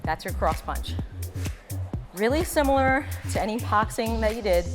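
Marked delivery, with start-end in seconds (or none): none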